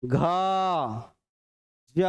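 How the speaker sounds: "घ, ज", each held long and voiced; resonant, ringing. Shown as a demonstration. Teacher proving the letters echo, "घ, ज" left resonating. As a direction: let each of "घ, ज" ring out separately. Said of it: "गूंज रहा है".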